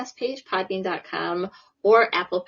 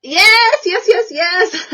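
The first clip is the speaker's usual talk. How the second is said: much higher and louder squealing yes, slowing at the end; thrilled yes; 10 semitones higher, 10 dB louder, slower than usual. Real, not acted. Host cheering the guest's Arnold impression, high delighted squeals.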